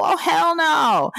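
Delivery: high, drawn-out mock-outraged refusal; hell no. Real, not acted. Host refusing to catch a cold, high mock outrage. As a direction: stretch it out high, refusing to be sick.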